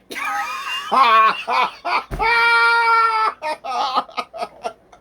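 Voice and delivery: howling laugh, half a shriek; loud high laughter. Real, not acted. Friends hear the roof story, howling laughter. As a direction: lose it completely laughing.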